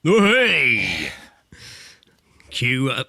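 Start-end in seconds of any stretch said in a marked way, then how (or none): none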